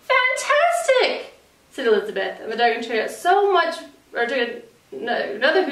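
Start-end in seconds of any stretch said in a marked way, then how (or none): 0.0-1.3 slow, high drawn-out praise; mock amazement. Princess flattering the dragon, slow and high. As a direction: lay the flattery on thick.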